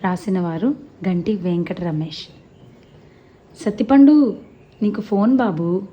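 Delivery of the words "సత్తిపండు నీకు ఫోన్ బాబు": wife calling loudly across the house. Acted. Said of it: "భార్య మయూరి పిలుపు".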